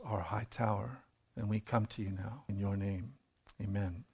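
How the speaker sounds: low, soft, reverent prayer voice. Acted. Pastor praying before the message, soft reverent prayer. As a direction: pray quietly to the Lord.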